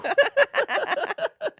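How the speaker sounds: a squealing, high burst of laughter. Real, not acted. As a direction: crack up at the punchline. Laughing at own story.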